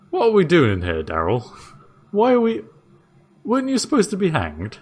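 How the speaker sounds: bewildered jailer, thinking it through aloud; Dave the jailer. Acted.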